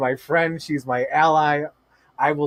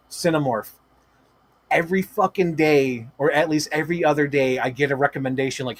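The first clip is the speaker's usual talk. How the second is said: normal talking voice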